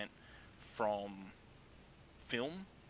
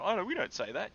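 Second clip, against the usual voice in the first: higher, louder mock Canadian chef denying it; hammed-up Canadian chef brushing it off, higher and louder; Canadian chef protesting; 10 semitones higher, 9 dB louder than usual. Retelling her Canadian chef friend's denial, higher and louder in mock protest. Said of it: "He would go".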